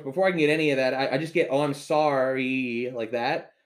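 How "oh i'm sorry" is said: very slow, dragged-out sorry; dopey apology. Retold or imitated. Customer cut off while apologizing, dopey drawl. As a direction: stretch SORRY out like a dimwit.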